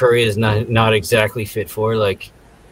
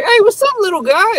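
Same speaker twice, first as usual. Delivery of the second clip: mock high greeting. Shorty greeting the parrot, very high voice. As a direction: squeal hello to the parrot. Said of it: "he's like".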